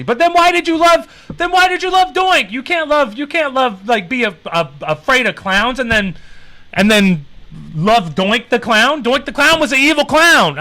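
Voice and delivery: high, incredulous teasing; mock outrage. Real, not acted. Mock outrage at a clown fan.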